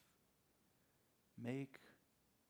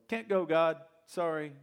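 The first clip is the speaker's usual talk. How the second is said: curt refusal; 4 semitones higher, 10 dB louder than usual. Acted. Moses refusing God.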